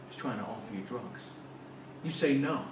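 ordinary delivery

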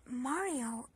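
normal talking voice

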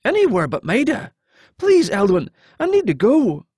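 high-pitched, urgent begging; desperate plea. Acted. Captive begging the hero to let him leave, desperate. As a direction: implore them to let you leave.

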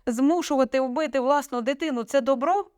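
high-pitched indignant question; bitter. A narrator's reading. Daughter confronting mother over her plea, bitterly. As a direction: challenge with bitter irony. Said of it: "Наталя гірко усміхнулася".

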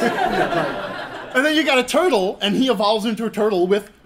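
amused mock disbelief; laughing. Real, not acted. Mocking Pokemon evolution, laughing.

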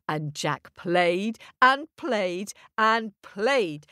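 usual speaking voice

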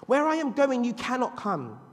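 high, loud proclamation of the line; preaching. Acted. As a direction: declare it from the pulpit. Jesus quoted, climactic.

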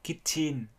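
KITCHEN as two equal full syllables, "kit-chin"; Vietnamese-style even syllables. Shown as a demonstration. Teacher imitating Vietnamese reading, KITCHEN in two even syllables. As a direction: give both halves of KITCHEN equal weight, Vietnamese style. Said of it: "hai âm đều theo kiểu của Việt Nam".

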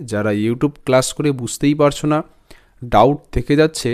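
ordinary delivery

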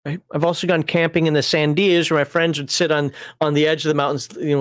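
normal talking voice

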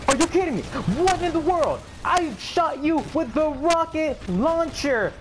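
high, drawn-out outraged yelling. Real, not acted. Player who missed with rocket launcher, outraged. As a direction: stretch each word in high disbelief, building.

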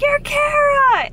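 high, loud, slow squeal of delight; excited fan squeal. Retold or imitated. Fan in the locker room greeting Kara, squealing with excitement. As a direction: squeal it like a thrilled fan spotting her idol. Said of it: "She was so excited".

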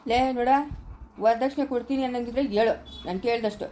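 ordinary delivery

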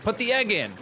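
high-pitched urgent inner voice; voicing own brain. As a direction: blurt it like a brain's sudden order. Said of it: "my brain was like".

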